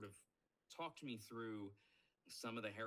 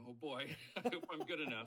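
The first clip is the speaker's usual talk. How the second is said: nervous laugh; 5 semitones higher, 6 dB louder than usual. Retold or imitated. Nervous audition waiting.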